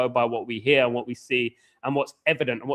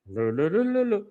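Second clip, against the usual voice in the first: voice practice syllables; 7 semitones higher than usual. Shown as a demonstration. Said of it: "start practicing my voice to lower my voice".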